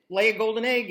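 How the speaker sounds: loud, firm command to the goose; a loud storybook command. Acted. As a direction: order it to lay. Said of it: "they just commanded it".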